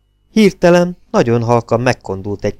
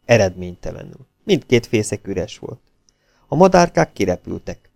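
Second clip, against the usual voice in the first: usual speaking voice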